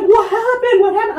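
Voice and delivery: high, loud urgent asking; alarmed questioning. Retold or imitated. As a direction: nurses rushing to find out what's wrong. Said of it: "they're like".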